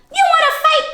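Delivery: squeaky high challenge; grouchy ladybug. A narrator's reading. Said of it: "said the grouchy ladybug".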